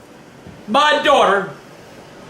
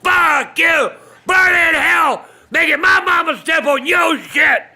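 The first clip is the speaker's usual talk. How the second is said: furious cursing; 7 semitones higher than usual. Real, not acted. Speaker cursing the dead prankster, furious high shouting. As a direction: curse him out with full rage.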